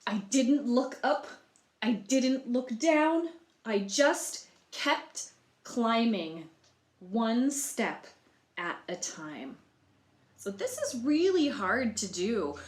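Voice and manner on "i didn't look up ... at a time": louder, slow and steady climbing rhythm; determined storytelling. A narrator's reading. Egg climbing the wall for the listeners, steady determined read. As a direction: pace it one step at a time. Reading the egg's story aloud.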